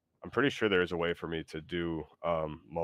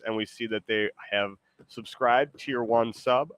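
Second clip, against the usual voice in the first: usual speaking voice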